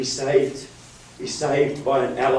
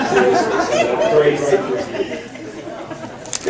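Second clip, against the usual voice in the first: mock-serious squeak; 14 semitones higher, faster than usual. Real, not acted. Playful aside to the audience.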